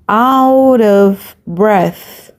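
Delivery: out of breath drawn out very slowly, each word stretched; very slow repeat. Shown as a demonstration. Teacher repeating out of breath for students to copy, very slow. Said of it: "teacher repeats phrase for students".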